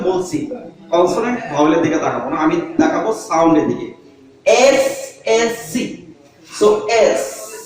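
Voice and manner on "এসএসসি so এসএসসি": "SSC" stretched out very slowly, letter by letter; slow spelling out. Shown as a demonstration. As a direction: draw out "SSC" slowly so the opening e sound is heard. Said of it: "এ sound হচ্ছে এস".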